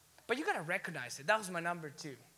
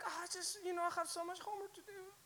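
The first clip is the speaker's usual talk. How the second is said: soft, high, feeble excuse; mock whining; 10 semitones higher, 9 dB quieter than usual. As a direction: whine it like a weak excuse. Mocking people making small excuses.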